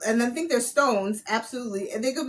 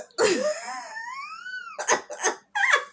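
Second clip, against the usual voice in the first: high shrieking cackle at her own joke; high squealing laugh. Real, not acted. Cracks up at the yoga joke.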